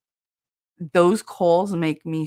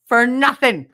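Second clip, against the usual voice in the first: "nothing" hit higher and louder; emphatic repeat; 6 semitones higher, 8 dB louder, slower than usual. Retold or imitated. Caller lamenting four hours wasted waiting, emphatically. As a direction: punch "nothing" in exasperation.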